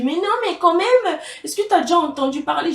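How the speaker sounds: a teacher pushing back at his pupil; surprised teacher. Retold or imitated. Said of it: "Il m'a dit".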